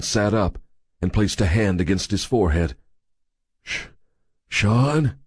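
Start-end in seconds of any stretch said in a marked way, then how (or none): 3.6-5.2 faltering, higher-pitched question; weak voice. A narrator's reading. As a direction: start the name weakly, break off, then ask it. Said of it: "he said weakly".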